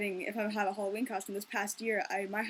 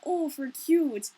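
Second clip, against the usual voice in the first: slow, higher, louder mock-Minnesotan "Oh, for cute"; 5 semitones higher, 6 dB louder, much slower than usual. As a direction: gush "Oh, for cute" in an exaggerated Minnesota accent. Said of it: "we're teasing ourselves".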